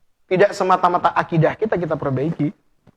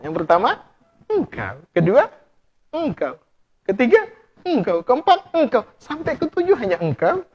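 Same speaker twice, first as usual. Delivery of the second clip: rising romantic build; 5 semitones higher than usual. Retold or imitated. Repeats the romantic answer.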